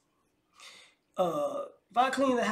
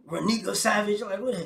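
ordinary talk

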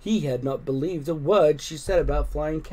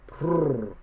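short rolled purr; trilled purring sound; cat purr. A narrator's reading. Roger answering the children, rolled purr. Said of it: "said Roger".

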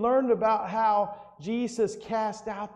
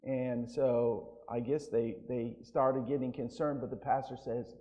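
ordinary talk, nothing marked

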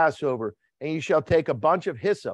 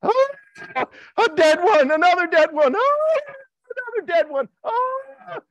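high loud wailing cries of grief; screaming cries; 7 dB louder than usual. Acted. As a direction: wail out like mourners in the night. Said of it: "hear the cries, the screams".

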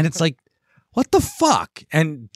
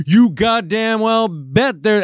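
a loud, slow, higher Jersey hillbilly snarl, imitated; 10 semitones higher, 4 dB louder, slower than usual. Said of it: "hick hillbilly accent".

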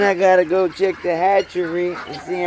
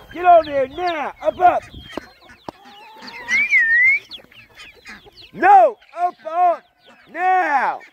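calling from far away. Real, not acted. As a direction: shout for the dog to come. Calling dog Shane from afar.

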